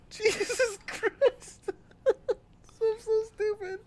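squeaky, breathless crying laughter; laughing too hard to get words out; helpless laughter. Real, not acted. Laughing at his own failed bit, breathless and high. Laughing fit, can't speak.

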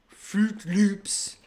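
imitated Norwegian character, drawn out and loud; Norwegian accent. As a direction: announce it in a hammy Norwegian accent. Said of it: "Fruit Loops said in a Norwegian accent".

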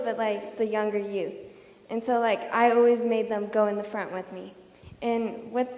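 ordinary talk

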